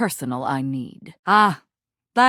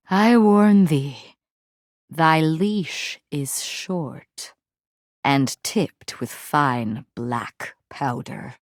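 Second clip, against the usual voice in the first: menacing warning; 5 semitones lower, much slower than usual. Acted. Knight issues threat after outburst.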